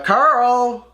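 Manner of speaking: Carl's name called out high and loud, startled; surprised call. Acted. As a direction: call out the name like you can't believe it. Rick spots Carl in the comic.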